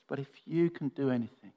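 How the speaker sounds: understated, weary plea; a touch of quiet desperation; desperate father. Acted. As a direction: plead softly for help. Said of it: "just hear the desperation in the father's voice".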